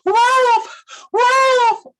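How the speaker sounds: high, loud shout of wolf; crying out. Acted. Shepherd boy yelling false alarm to the villagers, loud and high. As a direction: yell it to the village below. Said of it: "he would cry out".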